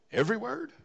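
high, rising "word?" in disbelief; incredulous. Acted. Preacher marveling to congregation at the verse, surprised. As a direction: ask it as if shocked.

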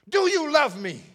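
high, loud plea; Jesus asking Peter again, high and loud; Jesus pleading. Acted. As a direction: call it out, reaching.

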